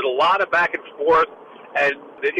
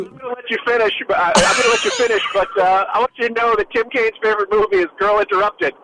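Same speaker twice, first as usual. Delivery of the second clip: higher-pitched mock interrupter; Tim Kaine; 4 semitones higher than usual. Panelist cutting off co-host to mimic the candidate, mock interrupting. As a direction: barge in over the other speaker, pitched up. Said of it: "that's my Tim Kaine impression".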